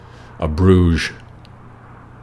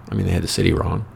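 ordinary talk

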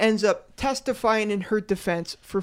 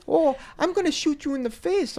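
higher, singsong hammed-up Minnesota accent; mock Minnesota accent; 7 semitones higher, faster than usual. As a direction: do a jokey Fargo-style Minnesota threat. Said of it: "sound like, um, the lady from Fargo".

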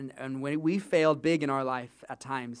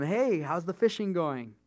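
drawn-out, higher-pitched call across water; yelling out; 5 semitones higher, 3 dB louder, slower than usual. Acted. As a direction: call out to a distant boat. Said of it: "somebody yells out to them".

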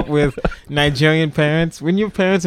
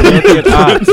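much higher, louder, laughing; 8 dB louder, slower than usual. Real, not acted. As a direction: laugh it out while insisting. Insisting Nigeria is the richest.

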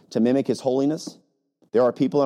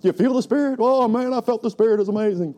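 high, louder gushing mock excitement; mock excited churchgoer; 10 semitones higher, 3 dB louder than usual. Pastor imitating excited congregants, high hammed-up gushing. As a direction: gush like a thrilled churchgoer. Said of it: "And people go".

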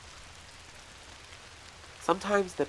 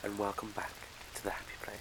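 soft, low, breathy purring greeting; breathy vintage purr; 4 semitones lower, 8 dB quieter, faster than usual. Acted. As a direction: purr it like an old-time film star. Sultry greeting to the listener.

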